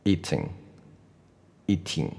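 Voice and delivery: teacher repeats "eating" slowly; eating demo. Shown as a demonstration. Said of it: "我再来一次".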